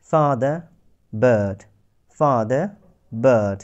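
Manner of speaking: father with plain ah, বার্ড with resonant er; ah versus er. Shown as a demonstration. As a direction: alternate father and বার্ড, contrast the vowels. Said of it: "প্রথমটাতে আমাদের কণ্ঠ কম্পিত হচ্ছে না".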